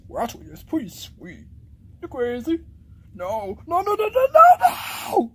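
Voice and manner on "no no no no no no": repeated no climbing to a high shout; escalating refusal. Acted. Rejecting one option after another, escalating shout. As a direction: build from raised voice to a loud, high yell.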